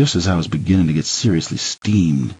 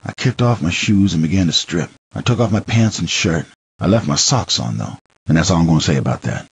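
ordinary delivery, nothing marked

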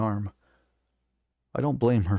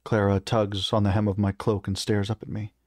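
ordinary delivery

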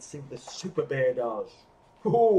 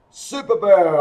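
a louder honk-like call; honking goose; 4 semitones higher, 10 dB louder than usual. Acted. Bruce the Goose calling out to Bear, a mild honk. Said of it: "honks Bruce the Goose".

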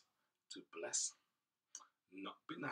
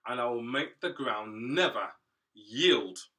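solemn judgement; 8 dB louder than usual. Acted. God pronounces Cain's punishment.

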